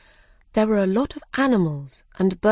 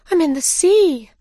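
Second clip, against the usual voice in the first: high, louder cry of surprise; startled exclamation; 10 semitones higher, 5 dB louder than usual. A narrator's reading. Alice has fallen into water, startled high exclamation.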